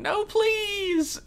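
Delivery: high-pitched mock plea; pleading victim. Acted plea of beaten Poppy.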